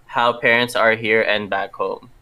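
ordinary talk, nothing marked

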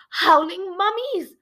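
high, loud, drawn-out squeak; stretched-out squeaky cry; squeaked exclamation. Acted. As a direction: squeal it slowly in alarm. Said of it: "she squeaked".

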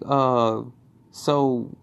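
normal talking voice

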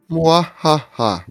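slow, drawn-out evil laugh; mustache twirling villain. Retold or imitated. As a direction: cackle it out slowly like a cartoon villain. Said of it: "Desse jeito mesmo".

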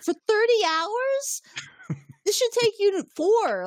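high, drawn-out disbelieving question; incredulous outburst. Retold or imitated. Host voices Riker doubting Geordi's repair time, incredulous. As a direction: retell it in high-pitched, hammed-up disbelief.

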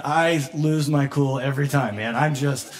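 ordinary talk

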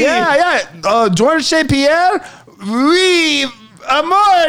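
high French Canadian caricature; mock French Canadian. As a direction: greet in a hammed-up French Canadian accent. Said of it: "they're like".

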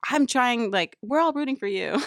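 high, loud imitation of a scolding judge; half-hammered TV judge telling a model off; intense Tyra Banks. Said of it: "She got intense".

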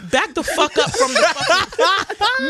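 hammed-up frustrated yell; mock yelling at Alexa. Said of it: "I be like".

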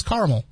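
"caramel" hurried into CAR-mul; skimmed-over A. Retold or imitated. Host imitating people who skip the A, "caramel" as CAR-mul. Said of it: "saying it so fast over that A".

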